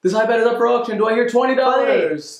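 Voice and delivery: high, lively auction call; auctioneer. Acted. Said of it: "An auction".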